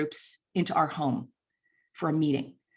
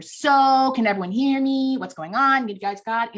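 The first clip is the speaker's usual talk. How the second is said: mock speaker testing the mic, higher and louder; mocking the nervous speaker; 5 semitones higher, 4 dB louder than usual. Ann Landers mimics the dad's shaky opening. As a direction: send up a fumbling opening. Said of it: "The first words out of your mouth were".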